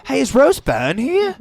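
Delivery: high, slow Australian call; Australian accent. Pretend. Friend calling for Rose Byrne in an Australian accent, high and slow. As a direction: call out like an eager Aussie fan.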